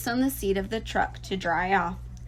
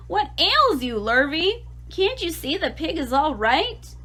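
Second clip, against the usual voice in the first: higher, louder drenched farmer scolding; exasperated bellow; 8 semitones higher, 4 dB louder than usual. A narrator's reading. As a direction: farmer soaked by Lurvy, scolding him. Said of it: "bellowed Mister Zuckerman".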